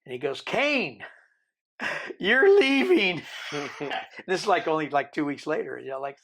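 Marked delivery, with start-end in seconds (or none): 0.4-3.2 high, loud, drawn-out call. Retold or imitated. Tank leader calling across the cells that he is leaving, loud slow call. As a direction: announce it across the tank, slowly.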